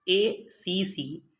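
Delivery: slow letter-by-letter एसीसी; spelling out letters. Shown as a demonstration. Teacher spelling out the letters slowly for students. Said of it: "इसकी spelling जो है वो बहुत important है".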